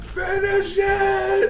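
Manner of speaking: high, slow shout. Real, not acted. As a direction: yell it out long. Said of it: "I had to say it".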